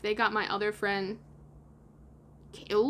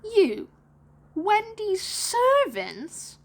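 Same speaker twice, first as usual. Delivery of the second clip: very high, loud, drawn-out shock; put-on boy, appalled and slow; incredulous; 10 semitones higher, 5 dB louder, much slower than usual. A narrator's reading. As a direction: swoop through the words in scandalised disbelief. John incredulous at the explanation.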